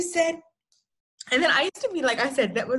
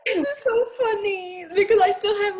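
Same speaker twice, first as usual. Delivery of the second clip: laughing; 10 semitones higher than usual. Real, not acted. Laughing while recalling.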